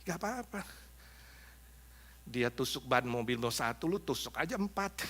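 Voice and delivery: hushed, sly tempter at the ear; quiet devilish coaxing; devil whispering temptation. Acted. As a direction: murmur it softly like the devil egging someone on. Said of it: "mulai bisik-bisik di telingamu".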